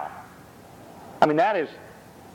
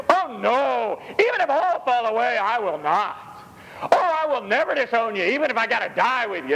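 proud boast; 8 semitones higher than usual. Retold or imitated. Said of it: "and the conclusion is".

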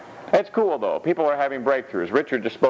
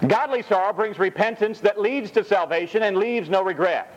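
scripture reading; 6 semitones higher than usual. Acted.

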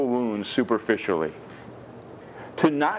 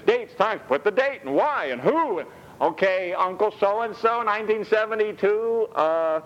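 high, animated comic riff voice; lively, pitched-up comic bit; comic storytelling. Acted. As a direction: ham up the doctor visit for laughs. Animated comic doctor-visit riff.

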